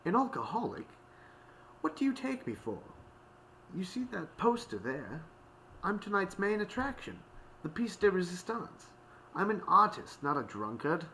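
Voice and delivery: high-pitched indignant protest; offended artiste, voice pitched right up; affronted. A narrator's reading. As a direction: protest like an insulted lady. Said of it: "Octavia audibly gasped".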